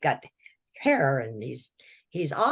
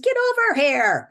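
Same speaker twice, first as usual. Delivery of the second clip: higher, louder barked summons; shouted command; 10 semitones higher, 7 dB louder than usual. Retold or imitated. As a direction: yell it across the room at him. Bloody doctor summons him.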